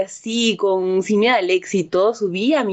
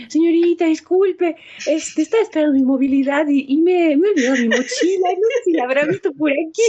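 timid plea; 8 semitones higher than usual. Retold or imitated. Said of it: "y le dije".

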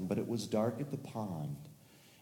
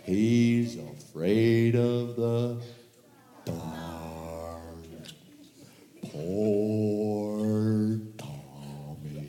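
slow, low pitying murmur; pitying sigh; 6 semitones lower, much slower than usual. Acted. Turtles pitying little Tommy, slow and low. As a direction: shake your head and pity him, slowly.

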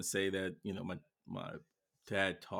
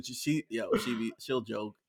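ordinary talk

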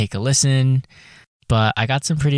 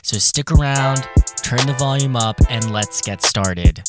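upbeat host intro read; podcast intro read; 3 dB louder than usual. Acted. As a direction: announce the show brightly. Produced intro read over music.

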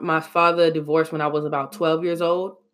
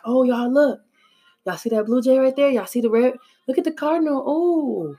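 mother's voice; 7 semitones higher than usual. Retold or imitated. Mother pointing out birds, voiced.

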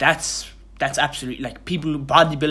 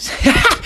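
high, breathy burst of laughter; embarrassed laugh; 8 dB louder than usual. Real, not acted. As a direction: giggle off the awkward moment. Laughs after awkward silence.

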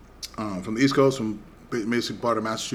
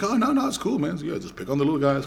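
high-pitched mock reassurance; teasing friend; 5 semitones higher than usual. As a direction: imitate a friend pretending it's fine. Retold friend Dave teasing.

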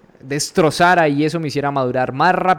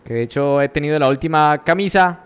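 ordinary talk, nothing marked

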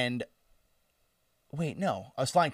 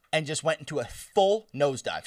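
ordinary talk

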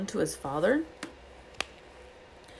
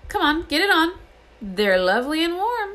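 loud, high coaxing; boisterous urging; 11 semitones higher, 7 dB louder than usual. A narrator's reading. George urges Ron into the sweater, loud and high. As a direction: egg him on noisily.

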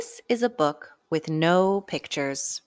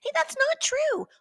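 much higher protesting squeal; high indignant squeak. Acted.

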